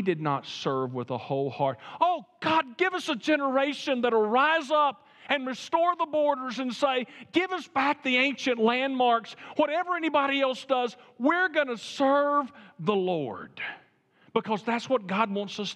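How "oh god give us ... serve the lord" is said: raised, higher pleading prayer; fervent preaching. Acted. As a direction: cry out to God for the next generation. Fervent prayer, preaching at full force.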